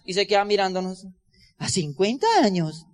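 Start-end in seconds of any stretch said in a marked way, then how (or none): 1.6-2.9 surprised disbelief. Retold or imitated. Clerk surprised at fifty-year term.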